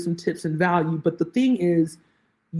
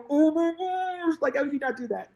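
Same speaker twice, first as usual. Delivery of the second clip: exasperated disbelief; 8 semitones higher, 5 dB quieter than usual. Retold or imitated. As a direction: drag it out in stunned disbelief. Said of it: "turn off my video and was like".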